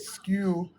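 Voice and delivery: skew said as "skyoo" instead of school; mock mispronunciation. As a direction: say skew as written, like a misread school. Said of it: "That's how she said it".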